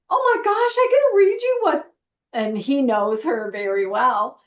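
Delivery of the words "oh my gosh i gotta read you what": high, rushed, gushing outburst; breathless-to-share excitement, pitched way up; excited retelling. Retold or imitated. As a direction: retell it bursting to share the news. Retelling her gushing reply to husband.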